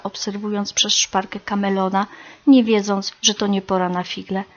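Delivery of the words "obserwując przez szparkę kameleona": ordinary delivery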